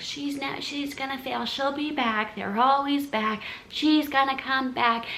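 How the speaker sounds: high, put-on girly gossip voice; mocking gossips. Bikini girls predicting she will fail, high mocking voice. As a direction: mimic doubters in a high singsong. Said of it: "the bikini girls were like".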